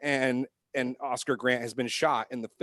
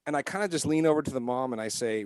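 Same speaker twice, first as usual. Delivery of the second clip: ordinary talk, nothing marked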